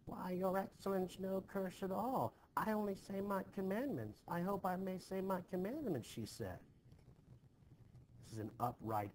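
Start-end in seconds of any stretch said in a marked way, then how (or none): 0.0-6.1 high whining beggar-woman voice, much higher; imitating old Sarah Good. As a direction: mimic the old woman's pleading innocence, pitched up high. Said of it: "And then she replied".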